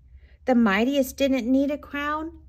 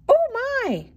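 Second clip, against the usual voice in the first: put-on very high, loud old woman's cry; startled cry; 5 dB louder than usual. A narrator's reading. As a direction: squeal it in storybook fright. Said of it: "cried the tiny old woman".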